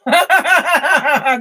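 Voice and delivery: hearty burst of laughter; loud laugh. Real, not acted. Amused at her own rant, loud laughing.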